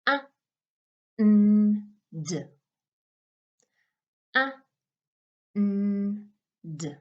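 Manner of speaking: A, n, d as separate sounds; sounding out words. Shown as a demonstration. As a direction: sound out A, n, d slowly. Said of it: "I'm going to sound out the word".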